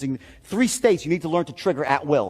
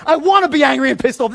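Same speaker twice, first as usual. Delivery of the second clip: loud, defiant retort; angry; 6 semitones higher, 5 dB louder than usual. Retold or imitated. Nephew loudly refusing his aunt's plea to calm down. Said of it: "Calm down, you're losing your state".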